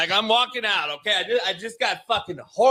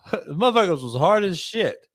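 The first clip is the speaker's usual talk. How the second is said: ordinary talk, nothing marked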